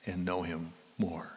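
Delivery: soft breathy whisper of the repeated words. Acted. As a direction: drop to a hushed whisper.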